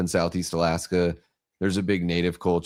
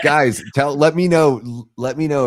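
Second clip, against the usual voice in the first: calling out; 6 semitones higher, 7 dB louder than usual. Real, not acted. Calling out to chat.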